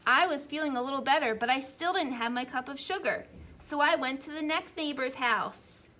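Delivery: a hint of a sly wolf pleading his case; wolf narrator. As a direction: play a self-justifying wolf telling his side.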